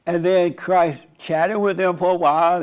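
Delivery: ordinary delivery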